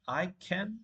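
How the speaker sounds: I can with a puffless, g-like k in can; can starting on an unaspirated k; wrong k sound. Shown as a demonstration. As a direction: say can almost as "gan".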